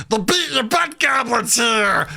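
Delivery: high, slow, mock-menacing goblin. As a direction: announce the threat like a goblin. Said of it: "I go".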